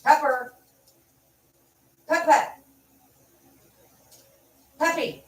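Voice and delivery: high-pitched call for a pet; calling the dog. Real, not acted.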